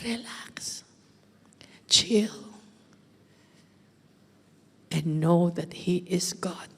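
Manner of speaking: soft, slow whisper; God's hushed reassurance. Acted. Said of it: "But God is saying". (pabulong na boses ng Diyos)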